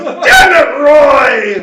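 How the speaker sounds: high, very loud guard's curse; exasperated shout. Acted. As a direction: bellow your exasperation at a fellow guard. Acted guards blame Roy, shouting.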